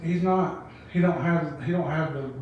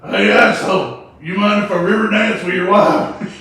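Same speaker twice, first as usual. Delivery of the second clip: cocky challenge; 4 semitones higher, 8 dB louder than usual. Retold or imitated. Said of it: "He said".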